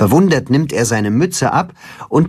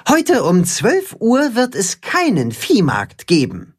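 high, measured proclamation; announcing; 9 semitones higher than usual. A narrator's reading. Timme tells the farm animals about the market, announcing. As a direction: announce it to the whole crowd, pitched up. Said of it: "kündigt Timme an".

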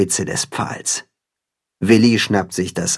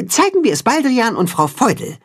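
instructing; 12 semitones higher than usual. A narrator's reading. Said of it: "weist das Katzenhuhn die Freunde an".

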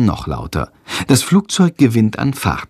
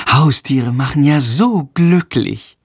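slow, higher, biting sarcasm; venomous sarcasm; 4 semitones higher than usual. A narrator's reading. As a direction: stretch it out with mock delight. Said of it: "giftet sie ihn an". (giftig, sarkastisch gedehnt)

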